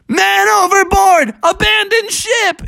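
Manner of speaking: high, drawn-out alarm shout; storybook sailor's emergency cry; fisherman yelling out. A narrator's reading. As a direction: holler the order to abandon ship. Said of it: "one of the fishermen yelled out".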